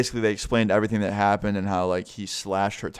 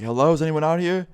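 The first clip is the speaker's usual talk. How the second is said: higher-pitched mild call-out; a retold call, raised in pitch; 7 semitones higher than usual. Boyfriend outside checking for an intruder, calling out.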